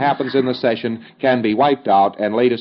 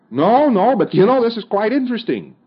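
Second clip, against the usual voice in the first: much higher, wondering voice; intrigued pre-clear; 10 semitones higher than usual. Acted. Client telling his auditor it's interesting, in a high, marvelling voice. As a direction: sound fascinated by what just happened.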